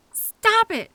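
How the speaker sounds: loud, high command; sharp yell. Acted. Driver yelling at coworker to stop. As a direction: shout them down. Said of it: "I yelled at you again".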